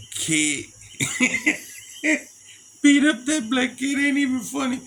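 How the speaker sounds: high laugh breaking into the words. Real, not acted. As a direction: laugh through the line. Laughing at own memory.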